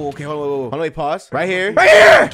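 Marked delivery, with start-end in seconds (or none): 1.7-2.3 sudden very high, loud shout; real full-force yell; battle yell. Real, not acted. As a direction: explode into a high yell mid-attack. Shouting during a fight.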